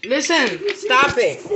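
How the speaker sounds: sharp, loud scolding. Real, not acted. Scolding the noisy kids.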